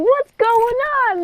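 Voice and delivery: high-pitched imitation of a bewildered mother; hammed-up high mom voice; mock alarmed mother. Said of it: "She's like".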